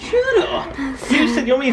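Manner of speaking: high squeal through laughter; laughing shut up. Real, not acted. Laughing protest at partner.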